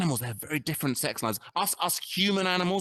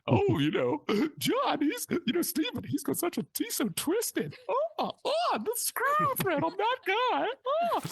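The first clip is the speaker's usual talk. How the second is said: very high, breathless-sounding lady's voice, swooning and slow; swooning mock lady; slower than usual. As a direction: swoon over the twisted guy in a high falsetto. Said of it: "imagine the lady, they're going".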